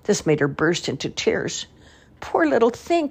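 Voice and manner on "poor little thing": lightly voiced, high, tender village woman; pitying woman. A narrator's reading. Woman comforts the crying girl, lightly voiced and pitying. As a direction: coo over the poor child. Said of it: "cried one of the women".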